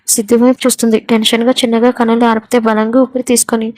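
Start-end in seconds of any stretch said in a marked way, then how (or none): none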